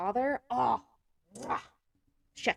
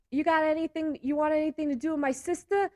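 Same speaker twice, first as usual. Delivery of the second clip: higher, louder hammed-up tough-guy voice; mock mobster threat; 8 semitones higher, 4 dB louder than usual. A brother warns his sister's boyfriend, hammed-up mobster threat. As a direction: threaten him like a cartoon wiseguy.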